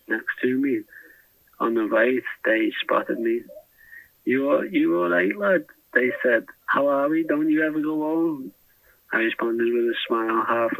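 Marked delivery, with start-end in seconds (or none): 4.2-5.6 mock Scouse greeting, pitched higher; imitating a London guard putting on Scouse; friendly put-on Scouse, raised pitch; Scouse accent. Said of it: "only in a Scouse accent". 6.7-8.5 higher, playful put-on Scouse; teasing jailer's fake Scouse; light mock Scouse banter; Scouse accent. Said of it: "only in a Scouse accent".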